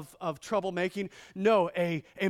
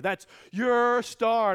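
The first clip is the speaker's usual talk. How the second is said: grand gesture; 6 semitones higher, 8 dB louder, much slower than usual. Acted. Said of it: "gifting a star grandly".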